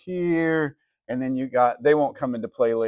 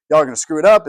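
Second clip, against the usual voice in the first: mock warning; 4 semitones higher, 9 dB louder, much faster than usual. God warns Israel beforehand.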